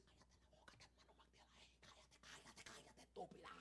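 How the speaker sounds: hissed furious whisper; a heated quarrel kept to a whisper; angry whisper. Retold or imitated. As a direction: whisper the insults so no one overhears. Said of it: "bajaron tanto la voz".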